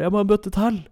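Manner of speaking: very high, loud pained yell; sick partner yelling; desperate demand. Retold or imitated. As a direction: cry out for another bucket. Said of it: "og hun bare".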